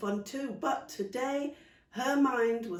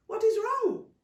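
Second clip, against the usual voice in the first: pressing aunt; 8 dB louder, slower than usual. Acted. Aunt pressing worried child.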